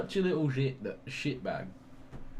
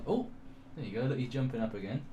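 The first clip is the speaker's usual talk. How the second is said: ordinary talk, nothing marked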